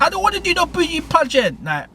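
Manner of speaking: high-pitched mock girl voice, pleading; protesting girl. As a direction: play the scared young woman saying no, high. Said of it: "He's like".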